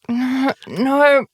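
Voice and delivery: high-pitched puzzled refusal; trying to help. Retold or imitated. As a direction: imitate someone gently drawing a blank. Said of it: "She's trying".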